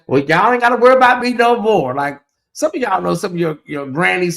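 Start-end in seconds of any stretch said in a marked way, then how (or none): 0.1-1.9 higher, louder mock granny voice, a little huffy. Mimicking grannies who pretend to stay out of it, higher and louder. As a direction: play a miffed granny bowing out of the drama.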